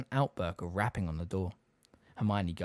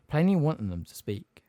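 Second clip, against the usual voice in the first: ordinary delivery, nothing marked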